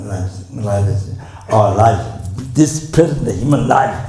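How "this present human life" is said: raised high and loud to drive it home; loud emphasis on a key teaching point; emphatic teaching. Real, not acted. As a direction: press the point with a louder, higher voice. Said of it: "but I'm emphasizing that".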